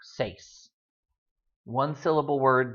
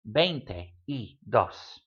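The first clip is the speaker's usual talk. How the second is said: very slow veinte y dos with a pause each side of y; broken into words; 3 semitones lower, much slower than usual. Shown as a demonstration. Teacher showing you can breathe mid-number, slow and broken up. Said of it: "stop and breathe in the middle".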